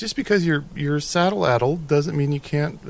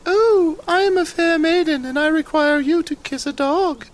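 very high, swooning damsel voice; fair maiden; 4 dB louder than usual. Pretend. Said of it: "watch this and see if you can tell the difference".

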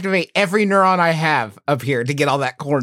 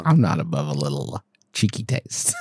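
put-on deep voice; 10 semitones lower, 4 dB quieter than usual. Confessing snack in odd voice.

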